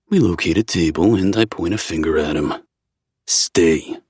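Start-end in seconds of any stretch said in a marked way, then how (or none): 3.3-4.0 commanding a dog. A narrator's reading. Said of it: "just like I do with Pom Pom".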